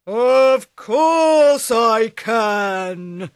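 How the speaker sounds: very slow, loud ogre boast; boastful ogre. Acted. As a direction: drag each word out proudly.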